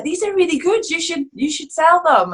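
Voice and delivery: high-pitched, eager urging; enthusiastic praise. Retold or imitated. Friends telling her to sell her homemade masks, enthusiastic praise. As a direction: gush like an impressed friend.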